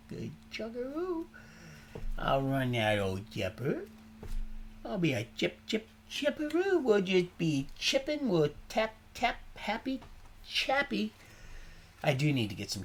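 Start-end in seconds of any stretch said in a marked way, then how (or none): 0.1-11.1 silly singsong. Retold or imitated. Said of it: "I said".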